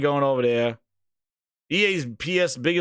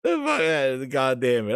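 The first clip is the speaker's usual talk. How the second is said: normal talking voice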